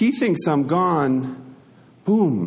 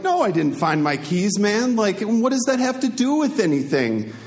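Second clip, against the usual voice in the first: pitched-up exasperated retort, higher throughout; exasperated outburst; 8 semitones higher than usual. Retold or imitated. Snapping back at the roommate who asks about keys, exasperated and pitched up. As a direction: fire it back, fed up and incredulous.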